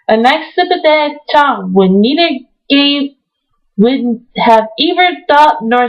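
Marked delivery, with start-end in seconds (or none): none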